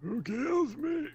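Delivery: character voice. Pretend. Mock voicing game character line.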